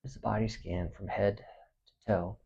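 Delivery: soft, unhurried meditation voice with long pauses; hushed, spacious relaxation guidance; guided body scan meditation. Acted. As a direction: guide them gently through the body, quietly.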